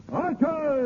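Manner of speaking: high call for the guards; summoning archers mid-fight. Acted. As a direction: shout it out to the archers.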